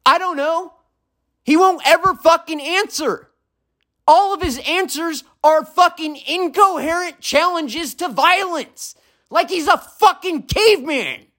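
furious, high yelling at the neighbor below; screamed rant. Real, not acted. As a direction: scream every word so the neighbor hears. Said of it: "this message was screamed in my apartment".

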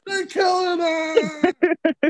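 high, very slow, tearful cry; choked up. Retold or imitated. Retelling a character's death to friends, tearful wail. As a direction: stretch it out, half sobbing, half shouting. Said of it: "I was getting choked up".

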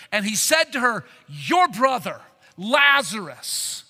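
a pastor dramatizing Jesus comforting Martha; emphatic preaching. Acted. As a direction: lean hard into each word.

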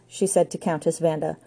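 ordinary delivery, nothing marked

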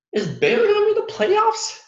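high, far-up mocking disbelief; incredulous squeak. Real, not acted. Vlogger mocks Baylor's playoff hopes, in high incredulous squeal. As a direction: squeal the question like it's absurd.

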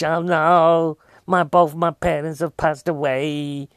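hammed-up, drawn-out mock wail; faking grief. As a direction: fake being grief-stricken, slow and overdone. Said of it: "you wouldn't know if she was faking, you know, being grief-stricken".

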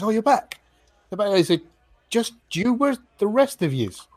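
high, loud chirpy bard. Acted. Bard greets the returning party in a high, lively voice. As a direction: pitch it way up like a chatty bard. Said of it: "he looks over and he goes".

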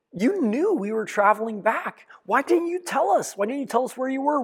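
a frantic mother berating her runaway son; Mary scolding the boy Jesus in anger; angry scolding mother. Retold or imitated. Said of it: "tears of anger".

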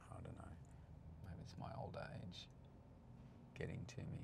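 a hushed mutter to himself; whispered aside. Real, not acted. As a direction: whisper it, as a wry self-joke. Joking aside about old age.

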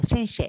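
"シェンシェ" with sh sounds for せ; 長崎なまりの発音. Retold or imitated. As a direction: imitate the parents' Nagasaki "シェンシェ". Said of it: "うちの両親とかだから". (両親の長崎なまりで「シェンシェ」)

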